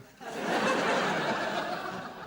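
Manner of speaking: loud burst of laughter; big laugh. Real, not acted. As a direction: roar of laughter. Audience laugh after the punchline.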